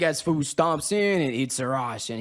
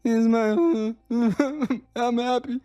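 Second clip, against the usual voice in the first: mock happy; 7 semitones higher, slower than usual. Acted reaction to the news.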